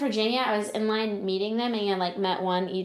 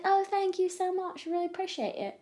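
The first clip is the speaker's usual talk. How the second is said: put-on British accent; 10 semitones higher, 4 dB quieter than usual. Thanking the band as a pretend Brit, British accent. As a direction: gush thanks as a fake Brit. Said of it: "I put on a British accent".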